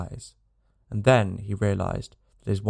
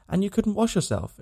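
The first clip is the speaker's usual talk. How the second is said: put-on grave child; 10 semitones higher than usual. A narrator's reading. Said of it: "interrupted his companion gravely".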